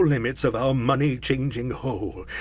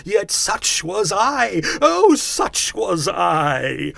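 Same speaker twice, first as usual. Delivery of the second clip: loud, raised ghostly lament; anguished cry; 6 semitones higher, 7 dB louder than usual. A narrator's reading. Phantom regretting its misused life, crying out in anguish. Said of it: "cried the phantom".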